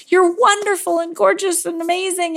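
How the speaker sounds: high, sustained gushing praise; Fab Five gushing. Acted. Cheerleaders praise someone before fixing them, gushing. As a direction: lay on the compliments in a high, acted voice.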